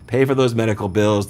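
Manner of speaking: normal talking voice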